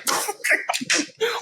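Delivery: airless high laugh; real laugh at the memory; breathy laugh. Real, not acted. Laughs at own story.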